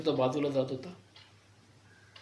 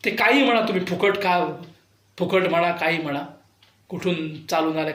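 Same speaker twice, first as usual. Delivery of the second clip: ordinary talk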